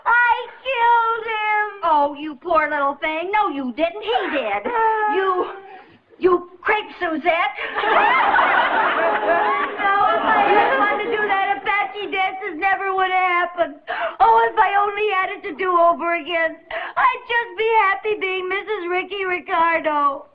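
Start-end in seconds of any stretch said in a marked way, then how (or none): 0.0-1.7 sobbing, slow and wailing; crying. Acted. Wife thinks husband dead. 4.0-7.6 crying. Acted. Wife blames the Frenchman, sobbing. 11.6-20.3 crying. Acted. Wife laments, sobbing.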